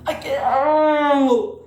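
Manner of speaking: laugh-crying. Real, not acted.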